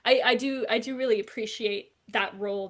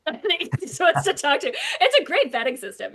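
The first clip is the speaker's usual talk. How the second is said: laughing; 6 semitones higher, 7 dB louder than usual. Real, not acted. Laughing aside.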